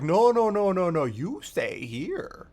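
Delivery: a hint of a menacing god king; lightly voiced, grabbing hold of a prize; possessive Oryx. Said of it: "and pulls it back through and is like".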